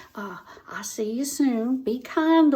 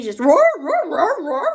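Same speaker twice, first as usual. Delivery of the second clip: dogs roaring; 6 semitones higher, 7 dB louder than usual. Retold or imitated. Imitating dogs baying at tree.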